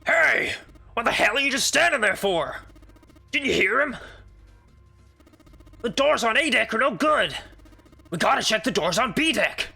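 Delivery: loud, high barked orders; big man shouting. Acted. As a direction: yell at him to move. Huge man yells at Junpei.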